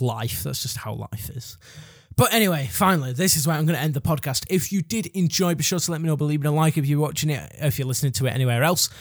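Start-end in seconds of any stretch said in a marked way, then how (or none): none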